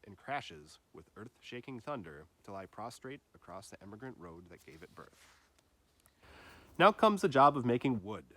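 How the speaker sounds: plain reading voice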